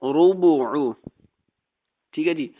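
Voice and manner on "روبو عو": روبو عو with a heavy را and a throaty عین; Quran pronunciation demo. Shown as a demonstration. Teacher corrects the student's روبو عو, precise. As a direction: model روبو عو, the را thick and the عین from the mid throat. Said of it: "را بھی موٹی، با بریک اور عین بریک".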